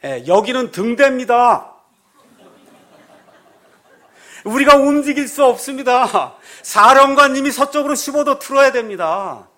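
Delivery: loud, high calm reply over a megaphone; the lighthouse calmly states it cannot move. Retold or imitated. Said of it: "그쪽에서".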